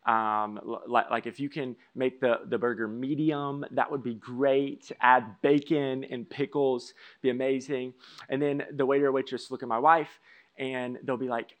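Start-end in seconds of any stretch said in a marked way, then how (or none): 0.1-7.9 slow ordering. Retold or imitated. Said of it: "and I'll say".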